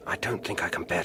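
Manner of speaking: whispered, uneasy and tense; frightened whisper. Acted. Alfred whispering to Piers, wanting to leave. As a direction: breathe it out, wanting to flee.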